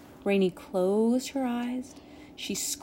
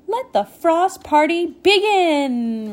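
high, loud, slow announcement; triumphant cry; 9 semitones higher, 7 dB louder, slower than usual. A narrator's reading. Rainy opens the frost party, crying out. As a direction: proclaim it to the crowd, stretching the words. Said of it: "Rainy cried".

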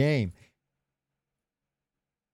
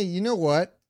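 normal talking voice

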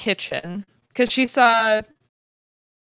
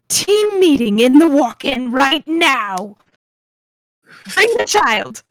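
loud, higher, drawn-out command; angry boss calling out orders; barked order; 5 semitones higher, 7 dB louder than usual. Acted. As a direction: storm in and summon the whole staff. Storms in, orders the staff.

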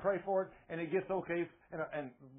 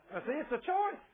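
laughing as he says it, pitched higher; 8 semitones higher than usual. Real, not acted. Preacher laughing to congregation about wife's cold feet. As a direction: chuckle through the words. Laughing at his own story.

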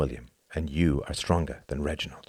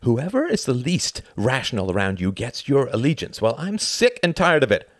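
raised, loud, mounting tirade; angry rant; 9 semitones higher, 5 dB louder than usual. Acted. Tom sick and tired of his friend's misplaced allegiance, furious. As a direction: let the frustration pour out, building.

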